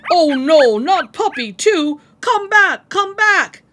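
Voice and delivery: loud, high calling after the dog; frantic shout. Acted. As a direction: yell after the escaping puppy. Puppy runs away too.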